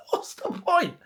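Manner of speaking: high, breaking into a laugh on what's the point; laughing disbelief. Real, not acted.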